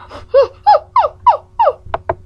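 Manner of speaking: high loud joyful laughter; happy laugh. Acted. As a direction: burst out laughing with delight. Laughs with joy.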